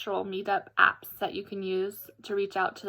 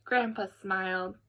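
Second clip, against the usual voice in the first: ordinary delivery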